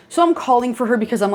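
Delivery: ordinary delivery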